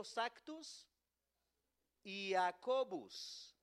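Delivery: Sactus Jacobus said very slowly, each syllable stretched; Latin repeat after me. Shown as a demonstration. Preacher slowly leading the church through Sactus Jacobus. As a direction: draw out Sactus Jacobus for them to echo. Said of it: "Diga conmigo".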